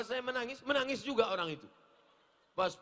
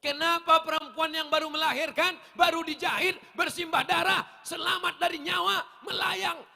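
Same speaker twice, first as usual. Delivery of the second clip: fiery preaching; 9 semitones higher, 10 dB louder than usual. Acted. Preaching at full force.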